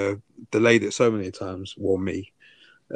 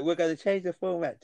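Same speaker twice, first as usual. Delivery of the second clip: higher-pitched mock of the co-host; mimicking co-host; 8 semitones higher, 4 dB quieter than usual. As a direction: parrot your co-host in a raised, mocking pitch. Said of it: "You're like".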